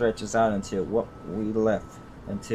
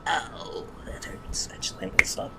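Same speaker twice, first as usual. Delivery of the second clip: breathy whisper of pain, barely voiced; 7 semitones higher, 6 dB quieter than usual. Narrator whispering her pain after being hurt. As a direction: wince and whisper it to yourself.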